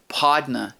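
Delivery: Podna with an aw vowel and no final r; Podna, ending on uh instead of er; drawled spelling pronunciation. Shown as a demonstration. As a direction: say Podna as if spelled pawd-nuh. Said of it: "spelled it P A W".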